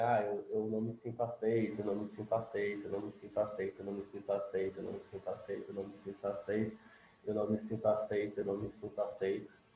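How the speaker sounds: a soft repeated chant that fades toward a murmur; fading tapping mantra. Shown as a demonstration. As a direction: murmur it over and over as you tap. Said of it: "Tô dando um exemplo".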